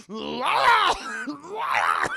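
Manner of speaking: loud, cracked mock scream; loud, half-laughing mock scream. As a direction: scream it wordlessly at her. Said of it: "just screaming at her".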